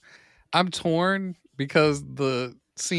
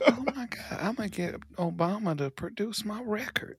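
higher, whispery scheming; mock hushed excitement; 4 semitones higher, 8 dB quieter than usual. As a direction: whisper it like a secret plan, playfully. Hushed scheming after hearing of Obama.

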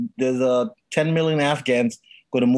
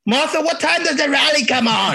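mock New Jersey; 11 semitones higher than usual. Husband calls to his wife Martha in a mock New Jersey accent.

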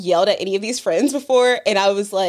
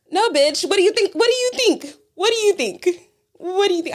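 much higher, rising exasperation on each repeat; exasperated retold rant; 10 semitones higher than usual. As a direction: hammer the question again and again, fed up. Furious retort to fast food worker.

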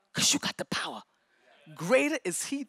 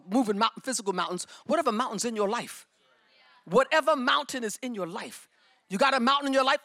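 ordinary talk, nothing marked

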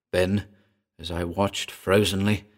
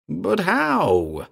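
high, stunned outburst; stupefied cry; 10 semitones higher than usual. A narrator's reading. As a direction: cry out in disbelief. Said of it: "I cried, stupefied".

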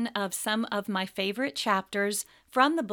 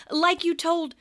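high, loud insistence; indignant schoolboy; young Harry, defensive; 9 semitones higher, 6 dB louder than usual. Acted. Harry voiced in read-aloud.

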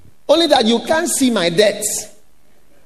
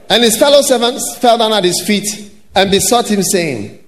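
ordinary delivery

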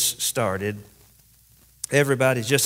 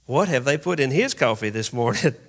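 normal talking voice

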